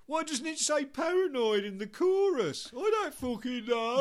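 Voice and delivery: high loud shrug of a yell; mock exasperated shout. Band member asking about the chorus, loud. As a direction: throw it out exasperated.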